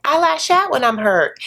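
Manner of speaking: high-pitched mock confessional voice; put-on high voice, stretched for effect; Angela. Recapper mimicking a reality star's confessional, high mock voice. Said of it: "in confessional where Angela's like".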